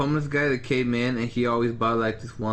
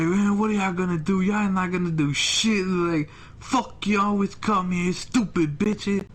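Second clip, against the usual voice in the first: retold defiant rant; 6 semitones higher than usual. Acts out the defiant customer's rant.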